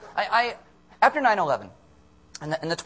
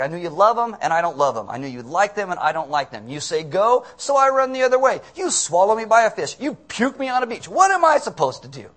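loud, exasperated mock rant, climbing higher; freaking out; 4 semitones higher, 6 dB louder than usual. Preacher as Jonah complaining to God, freaking out. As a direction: rail at someone in hammed-up frustration. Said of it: "he's just freaking out".

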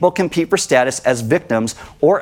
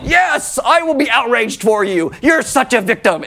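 hammed-up fake sympathy, pitched way up; mocking outrage; 8 semitones higher than usual. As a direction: parody the eager defenders online. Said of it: "people are like".

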